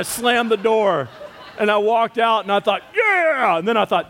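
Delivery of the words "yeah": much higher, self-satisfied yeah; smug triumph. Retold or imitated. Husband proud of his exit, smug and much higher. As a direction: savor the win, mockingly. Smug thought after slamming door.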